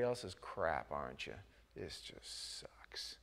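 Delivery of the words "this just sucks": a hushed, sulky whisper, slow; slow mock-sulky whisper to yourself; whispered complaint. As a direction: mutter the self-pity under your breath, slowly. Complaining in the valley.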